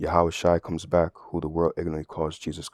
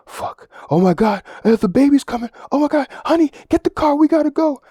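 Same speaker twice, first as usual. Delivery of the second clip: shrieking much higher and louder in mock labour panic; panicked movie wife; 9 dB louder than usual. Movie wife in labour yelling at her husband, frantic high-pitched shriek. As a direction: ham up the terrified mother-to-be whose water just broke. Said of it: "if you watch movies, they'll be like".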